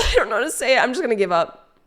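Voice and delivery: ordinary talk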